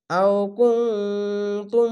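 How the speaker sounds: আও কুনতুম very slow with a humming নুন; nasal ghunna. Shown as a demonstration. Teacher showing the ghunna, slow nasal recitation. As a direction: hum the ন in কুনতুম, slowly. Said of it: "ইদগামে মিম সাকিন গুন্না".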